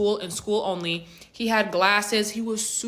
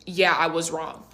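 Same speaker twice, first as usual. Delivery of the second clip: usual speaking voice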